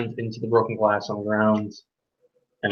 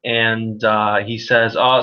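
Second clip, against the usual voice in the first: ordinary talk, nothing marked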